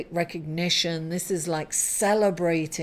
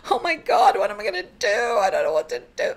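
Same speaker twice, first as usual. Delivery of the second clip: mock panic; 3 dB louder, much faster than usual. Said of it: "or you can be like".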